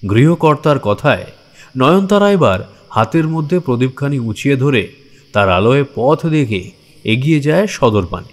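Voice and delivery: usual speaking voice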